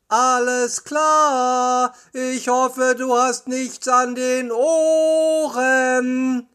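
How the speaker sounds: slow, high, drawn-out call; calling back. A narrator's reading. Justus answering Peter in the tunnel, drawn-out calling. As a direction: stretch the words to carry down the tunnel.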